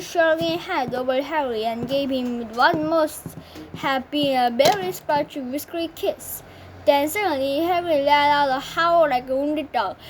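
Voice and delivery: plain reading voice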